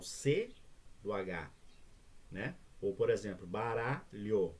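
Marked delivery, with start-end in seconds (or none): 3.5-4.5 "baralho" slowly split into syllables. Shown as a demonstration. Teacher showing where baralho divides, syllable by syllable. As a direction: break "baralho" into ba-ra-lho with small gaps. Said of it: "eu não posso separar o L e o H".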